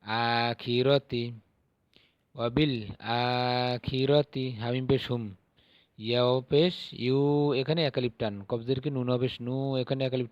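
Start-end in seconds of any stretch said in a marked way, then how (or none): 0.0-4.4 আখিরতি very slow, each syllable drawn out; slow Arabic recitation. Shown as a demonstration. Teacher modelling Quranic reading slowly. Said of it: "তাজের তি".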